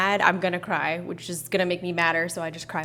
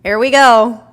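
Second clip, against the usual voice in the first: a loud, higher mock of his catchphrase; hammed-up imitation of his catchphrase, louder and higher; imitating catchphrase; 5 semitones higher, 16 dB louder, slower than usual. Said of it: "he'll always say".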